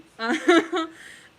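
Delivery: loud high laugh. Real, not acted. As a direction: burst out laughing. Laughs at sexy fighting.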